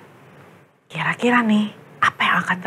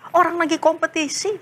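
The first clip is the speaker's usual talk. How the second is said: ordinary delivery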